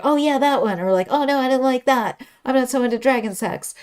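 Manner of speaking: hammed-up animated chat; animated mock chatter. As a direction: act out an eager exchange of opinions. Acting out animated smut book chat.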